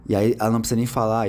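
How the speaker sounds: ordinary delivery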